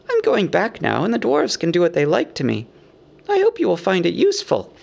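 awkward, high-voiced hobbit justifying himself; uncomfortable. A narrator's reading. Burglar Bilbo explains his gift, uncomfortably. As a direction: hedge and excuse yourself in a high voice. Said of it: "said the hobbit uncomfortably".

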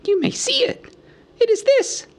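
very high hobbit voice; hobbit unveiling treasure. A narrator's reading. Bilbo shows the Arkenstone, high and deliberate. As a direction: present it in a squeaky voice.

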